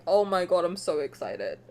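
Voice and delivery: usual speaking voice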